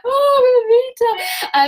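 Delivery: high, loud sobbing wail of the crowd; mock crying crowd. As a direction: wail her name like a weeping townsperson. Townspeople crying in the square.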